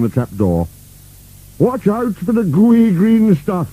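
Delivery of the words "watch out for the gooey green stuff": high, drawn-out warning cry; shouted warning. A narrator's reading. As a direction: call out a warning, slowly and high. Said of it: "shouted Boney".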